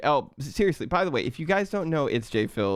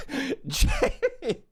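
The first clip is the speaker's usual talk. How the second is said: laughing. Real, not acted. Surprised by gift.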